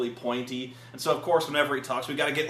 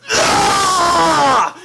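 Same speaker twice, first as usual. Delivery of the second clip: very high, very loud roar of rage; hammed-up monster scream in close-up; villain battle scream; 15 dB louder than usual. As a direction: scream it full out like an angry warlord. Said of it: "so he's like".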